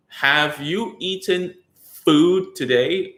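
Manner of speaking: slow, over-articulated therapy speech; exaggerated slow speech drill. Shown as a demonstration. Therapist mocking an over-drilled way of talking, slow and exaggerated. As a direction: mouth every word slowly like a lip drill. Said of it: "We do not go like".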